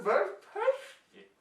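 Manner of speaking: sudden high-pitched squeak. Acted. Tasting mushroom, high squeak.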